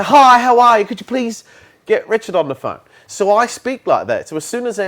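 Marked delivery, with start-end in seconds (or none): 0.0-2.8 bright, much louder and higher polite greeting; overly polite caller. Shown as a demonstration. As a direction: gush the niceties before asking. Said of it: "He would say".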